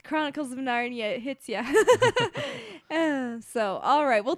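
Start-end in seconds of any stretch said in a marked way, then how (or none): 1.7-2.9 a burst of high, loud laughter. Real, not acted. Cohost laughing as she wraps up the talk, high and loud.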